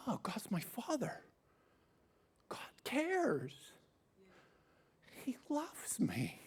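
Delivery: tender hushed whisper of realisation. Acted. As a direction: whisper it as if discovering it yourself. Inner realisation of being loved.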